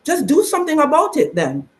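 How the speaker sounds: imitating mom. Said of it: "she said".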